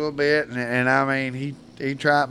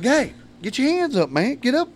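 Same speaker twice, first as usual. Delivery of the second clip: high, raised coaching call; impatient call; 10 semitones higher than usual. Retold or imitated. Coach repeats the order to the boy, raised and impatient. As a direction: push him, getting short with him.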